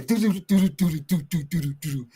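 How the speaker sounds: imitated drum beat, fading away; band tune hummed. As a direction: hum the band's beat as it fades.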